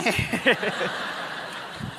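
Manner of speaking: long voiceless wheezing laugh; breathy laugh. Acted. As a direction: laugh on little but air, fading. Laughs at own confession. (cười khúc khích ra hơi)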